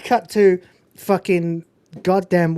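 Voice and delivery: ordinary talk, nothing marked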